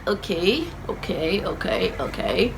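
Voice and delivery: dazed child in pain, imitated; weak okay. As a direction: murmur it, stunned and hurting. Hurt child answering weakly.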